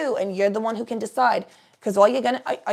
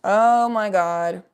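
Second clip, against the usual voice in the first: put-on Jersey accent, loud and drawn out; 6 dB louder, much slower than usual. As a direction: lay on a Jersey Jenny accent. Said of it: "I'm doing the wrong accent".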